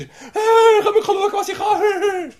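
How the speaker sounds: high, laughing show-off voice; squeaky mocking boast. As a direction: play a bragging showman, tongue in cheek.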